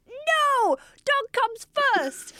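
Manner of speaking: very high, drawn-out put-on protest; high mock refusal. Acts out actor's refusal.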